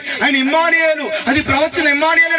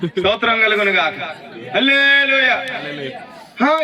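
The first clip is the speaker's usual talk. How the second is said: ordinary talk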